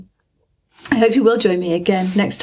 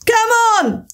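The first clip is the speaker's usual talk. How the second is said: cried; 12 semitones higher than usual. A narrator's reading. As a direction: shout to hurry her along. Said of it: "cried the Gryphon".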